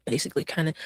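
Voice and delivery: mock-quiet imitation of her evasive answer; mocking mumble. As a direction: mutter it back as if catching her dodge. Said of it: "Oh, wait".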